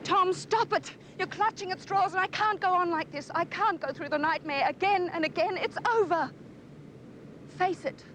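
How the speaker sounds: upset shouting. Acted. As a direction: cry out at him to stop. Distraught woman pleading with Tom.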